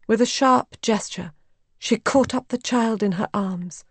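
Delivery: plain reading voice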